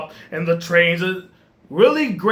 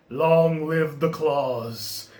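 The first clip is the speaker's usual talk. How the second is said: sinister slow line; 4 semitones lower, 4 dB quieter, much slower than usual. Retold or imitated. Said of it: "he's like".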